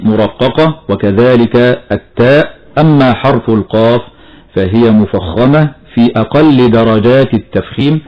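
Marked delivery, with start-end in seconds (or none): none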